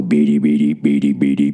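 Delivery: low, droning robot chirp; robot beeping. Retold or imitated. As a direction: do the little robot's beeping before it speaks. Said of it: "it'd go".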